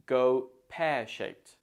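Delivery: slow GO PEAR-SHAPED, PEAR stressed. Shown as a demonstration. Teacher shows where stress falls, slowly stressing PEAR. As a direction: pause after GO, then stress PEAR in PEAR-SHAPED. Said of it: "the stress falls on pear".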